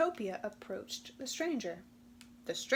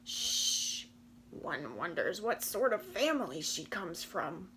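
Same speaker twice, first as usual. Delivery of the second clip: lower, snooty gossiping voice; snooty whisper; 4 semitones lower than usual. Acted. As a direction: sniff at her family in a hush. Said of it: "wondered Rambunctious and Ramshackle".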